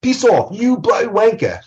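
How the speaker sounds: loud, high British insult; put-on British accent. Teacher showing accents to class, mock British outburst. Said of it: "I could use accents".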